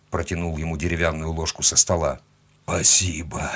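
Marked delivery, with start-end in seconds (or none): 2.6-3.5 faint hoarse thanks; slightly husky, wry thanks; hoarse chuckle. A narrator's reading. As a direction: rasp it with a hint of a smirk. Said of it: "хрипло усмехнулся он".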